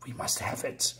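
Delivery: breathless eerie whisper of desire; ghostly whisper. Acted. Demons in the corpse whisper their craving for power.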